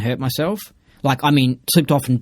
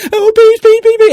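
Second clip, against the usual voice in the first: much higher, louder mock shriek; mock panic; 10 dB louder than usual. Mocking the girl freaking out, high shriek.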